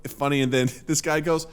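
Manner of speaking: ordinary talk, nothing marked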